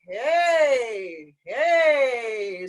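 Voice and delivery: loud, full chest-voice hey; impressive hey. Shown as a demonstration. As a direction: let out a big strong hey. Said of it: "a very impressive hey".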